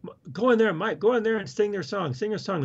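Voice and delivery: mimicking his cousin pushing him; cousin urging. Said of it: "She says".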